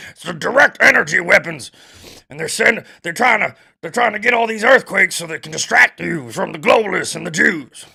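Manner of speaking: loud, high ranting conspiracy voice; Alex Jones impression. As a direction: rant like a paranoid radio host. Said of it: "That's the only good impression I have".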